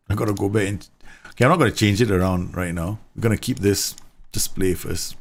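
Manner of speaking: ordinary delivery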